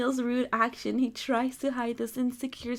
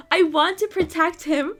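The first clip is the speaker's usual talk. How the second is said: high, loud, flustered laugh; 5 semitones higher, 8 dB louder than usual. Real, not acted. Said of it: "laughing flustered reading".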